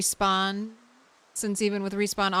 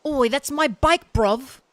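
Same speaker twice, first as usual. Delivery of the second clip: British Oi; 4 semitones higher than usual. Retold or imitated. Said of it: "retrain my brain".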